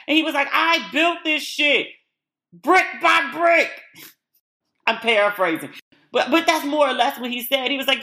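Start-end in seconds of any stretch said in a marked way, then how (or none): 0.5-3.7 slow, higher, hammed-up shout of a proud husband; mock Steve rant. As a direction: play Steve bellowing over his house, drawn out.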